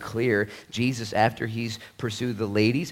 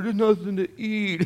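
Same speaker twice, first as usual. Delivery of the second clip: put-on whiny kid; 10 semitones higher, 4 dB louder than usual. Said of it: "they open up the cupboard".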